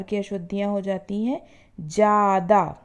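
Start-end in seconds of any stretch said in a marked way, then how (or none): none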